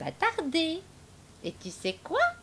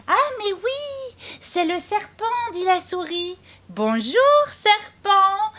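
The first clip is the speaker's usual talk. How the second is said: cheeky mouse; 10 semitones higher, 6 dB louder than usual. Acted. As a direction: call out sweetly to the snake. Mouse greets snake.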